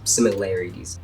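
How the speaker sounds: ordinary talk, nothing marked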